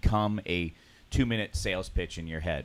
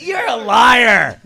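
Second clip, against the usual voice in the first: loud, slow teasing yell of liar; hammed-up accusation, half laughing; mock shout; 11 semitones higher, 13 dB louder, much slower than usual. Teasing a friend over his favorite movie, mock shout.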